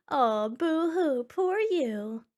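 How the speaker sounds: slow, drawn-out fake pity; mock sympathy. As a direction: coo it sarcastically, dragging the words. Reads a comment mocking the husband.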